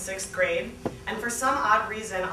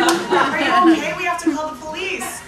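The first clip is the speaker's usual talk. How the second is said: higher, louder, urgent worry; alarmed roommate; 9 semitones higher, 9 dB louder than usual. Acted. Roommate urging Mark to call police, alarmed and high. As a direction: plead with rising alarm, higher up. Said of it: "my roommate said".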